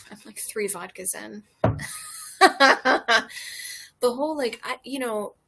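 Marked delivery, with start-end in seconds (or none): 1.6-4.0 loud laugh. Real, not acted. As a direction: burst of tipsy laughter. Laughs after "three vodkas in.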